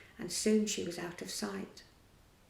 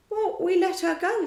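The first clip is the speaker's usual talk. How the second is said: higher, louder nervous reply; a worried underling excusing himself; nervous pirate; 10 semitones higher, 7 dB louder than usual. A narrator's reading. Smee nervously answers Hook, higher and louder.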